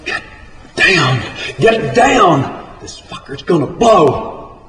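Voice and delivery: urgent high-pitched yell; yelling a warning. Acted. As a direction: holler up to warn the man on the derrick. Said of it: "crew boss yelling".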